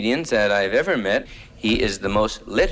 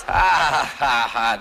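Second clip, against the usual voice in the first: mock laugh; 3 semitones higher, slower than usual. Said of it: "So there he goes".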